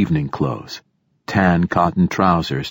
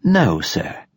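too smooth android; 8 semitones higher than usual. Acted. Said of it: "said A Betic".

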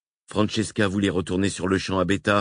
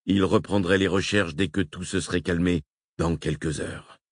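usual speaking voice

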